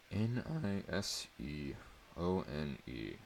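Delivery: soft, slow spelling under the breath; mumbling to himself. A narrator's reading. Boy reading the note alone, soft mumble. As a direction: spell it out softly to yourself. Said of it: "I mumbled to myself".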